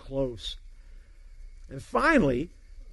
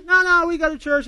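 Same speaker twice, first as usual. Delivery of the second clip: hasty protest; 9 semitones higher, 5 dB louder than usual. Retold or imitated. Other two men hastily protest.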